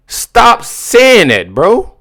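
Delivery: high, drawn-out plea to stop; exasperated plea. Real, not acted. Reacting to a clip, pleads with the speaker to stop. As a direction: beg him to stop saying it, stretched and pained.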